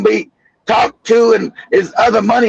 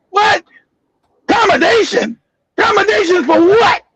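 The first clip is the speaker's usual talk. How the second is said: retold snapping outburst; 7 semitones higher than usual. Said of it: "that's where I snapped".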